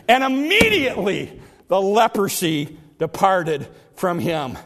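ordinary talk, nothing marked

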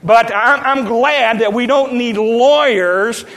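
rising preacher cadence, pushed hard on each phrase; fervent preaching. Acted. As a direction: drive the sermon home.

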